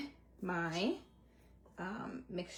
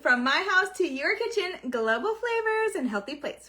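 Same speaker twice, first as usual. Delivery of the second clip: show sign-off; 8 semitones higher, 5 dB louder than usual. Acted. Signature show outro.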